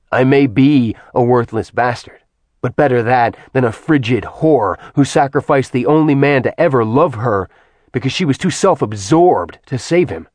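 bury any hope of peace with rage; furious man accusing the goddess; cold fury. Acted. Acheron insults Artemis.

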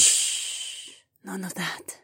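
soft hushing murmur. A narrator's reading. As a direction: hush him gently, barely voiced. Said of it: "Zoe murmured".

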